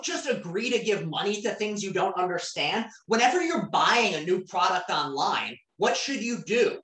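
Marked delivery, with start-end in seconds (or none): none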